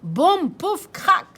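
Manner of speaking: loud punchy sound words; crash effect. Acted. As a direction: bang out the crash. (boum pouf crac lancés fort)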